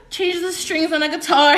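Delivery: high, loud, giggly mock plea; laughing joke. Real, not acted. Streamer jokes about needing a man's help, loud giggly plea. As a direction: burst out with it through laughter.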